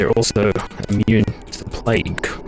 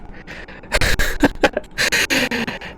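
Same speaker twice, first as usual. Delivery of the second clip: high breathy burst of laughter; startled laugh; 14 semitones higher than usual. Real, not acted. Gamer laughing after a scare alert makes him jump.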